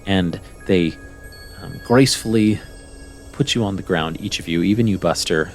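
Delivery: ordinary talk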